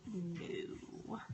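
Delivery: low, very soft retold no; hushed refusal. Midwife rules out coffee and tea, imitated in a hushed voice. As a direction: drop to a near-whisper for the answer.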